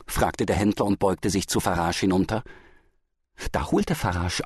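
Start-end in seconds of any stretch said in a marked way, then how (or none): none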